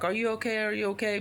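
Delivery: a touch of a worried nurse; concerned staff. Retold or imitated. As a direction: ask anxiously if the patient is okay. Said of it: "And they're like".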